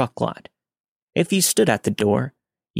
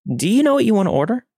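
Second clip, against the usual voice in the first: much higher, quick insistent question; smile dropped, insistent; 11 semitones higher, 3 dB louder, faster than usual. A narrator's reading. Waitress repeating herself to a leering customer, smile dropped, insistent. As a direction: press for the answer, pitched high and fast. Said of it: "I drop the smile".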